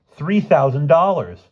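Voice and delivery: loud, slow excited news; excited exclaim. A narrator's reading. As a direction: announce it with excitement. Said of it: "Bruno exclaimed".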